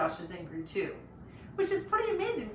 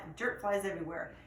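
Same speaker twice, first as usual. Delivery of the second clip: ordinary delivery, nothing marked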